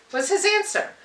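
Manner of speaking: ordinary delivery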